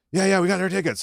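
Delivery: nervous. Retold or imitated. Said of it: "And we're like".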